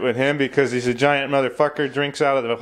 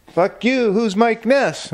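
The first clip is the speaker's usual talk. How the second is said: much higher, mock-defensive clone; 9 semitones higher, slower than usual. Clone denying he knows [name], high mock voice. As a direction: squeak a defensive denial up high. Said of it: "they're like".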